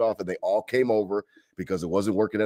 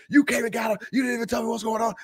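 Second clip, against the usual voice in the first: mock ex-wife; 14 semitones higher than usual. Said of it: "She's like".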